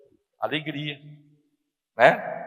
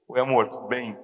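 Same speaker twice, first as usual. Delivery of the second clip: low, soft phone greeting to a wife; tender; 7 semitones lower, 5 dB quieter than usual. Retold or imitated. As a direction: greet your darling gently. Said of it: "Fala com carinho".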